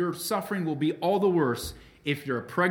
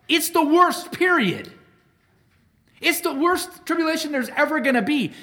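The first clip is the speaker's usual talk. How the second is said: louder and higher, pounding the word worst; emphatic preaching shout; 10 semitones higher, 7 dB louder than usual. Real, not acted. Preacher to his congregation, booming emphatic shout. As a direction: hit it hard like a preacher driving the point home.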